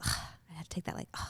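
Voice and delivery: hushed, voiceless ugh of frustration; imitated frustrated grunt. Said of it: "grunt, you know?".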